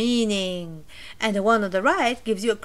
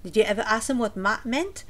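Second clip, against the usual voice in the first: ordinary talk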